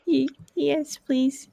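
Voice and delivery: tiny, high, soft voice; shy scared child, barely daring to ask; timid little girl. Acted. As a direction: answer meekly with a small stammer. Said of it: "sad and scared".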